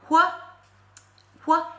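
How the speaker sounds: ordinary delivery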